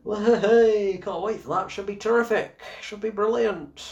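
high, mock-cheery sarcasm; sarcastic. Reviewer mocking Venom three hopes, high and sarcastic. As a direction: fake enthusiasm that means the opposite.

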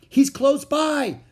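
high, loud, drawn-out call; excited high shout. A narrator's reading. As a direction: holler the discovery slowly. Calling while searching for dog.

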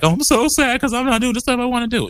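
high mock-sad voice; high-pitched mock sob story; mock sad self. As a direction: play up her own sadness, high. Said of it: "I was crying 'cause I was like".

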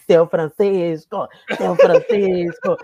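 slow, loud drawn-out call through laughter; calling out loudly. Retold or imitated. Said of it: "Na mesma hora eu falei".

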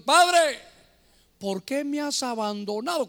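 high, loud opening cry, then easing; acted cry of abandonment from the cross; anguished cry. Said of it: "el Señor se sentiría angustiado". (clamor angustiado, actuado)

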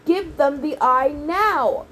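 slow, pressing urgency. A narrator's reading. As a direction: push him to act now. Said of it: "Annabeth said more urgently".

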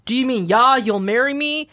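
ordinary talk, nothing marked

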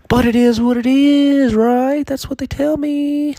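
squeaky high put-on voice, loud at first; high silly voice shrugging it off; mock high voice. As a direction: pipe it up like a cartoon. Mock high voice on sad joke.

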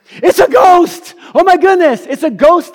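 high, loud panicked yell; crying out in fear. Acted. Disciples spotting Jesus on the lake, crying out in fear. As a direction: shriek it out in terror. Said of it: "they cried out in fear".

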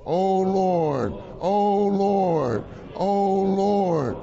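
prayerful calling oh Lord. Pretend. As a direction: cry out oh Lord with longing. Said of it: "I'm just quoting the ministry".

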